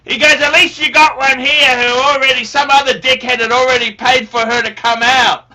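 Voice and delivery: loud, high mock father. Son imitates father's reply, loud and high. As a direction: bark it like a blunt old dad. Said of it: "he said to me".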